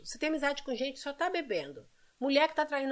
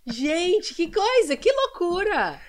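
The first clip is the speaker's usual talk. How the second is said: higher and louder, stretching out the last words; astonished; 7 semitones higher, 6 dB louder, much slower than usual. Real, not acted. Host reacting to a guest's wild story, astonished and slow. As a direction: gasp it out in disbelief, drawing out loucura.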